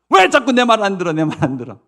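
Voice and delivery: high, loud burst that subsides; acted fed-up mother; exasperated scolding. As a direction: demand to know why you're ignored. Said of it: "그리고 막 이제 혼냅니다".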